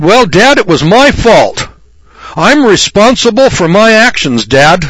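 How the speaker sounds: hammed-up obedient boy owning his faults; a lightly voiced penitent child pleading; mock dutiful son. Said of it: "I never said".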